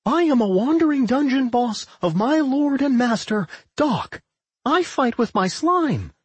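put-on high-pitched, proud slime girl; high voice swelling with pride; proud proclamation. A narrator's reading. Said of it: "proclaimed proudly".

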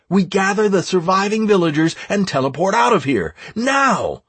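rally the troops with a high, pressing call; shouting orders. A narrator's reading. Raid leader to players storming the village, shouting orders. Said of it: "I continued to shout orders left and right".

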